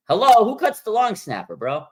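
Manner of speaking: hello, are you serious?; real disbelief at the cut; incredulous. Real, not acted. Disbelief at cutting long snapper.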